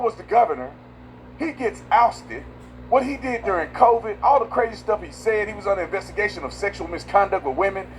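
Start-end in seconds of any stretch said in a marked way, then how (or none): none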